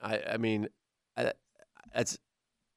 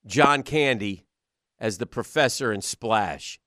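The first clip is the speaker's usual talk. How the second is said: usual speaking voice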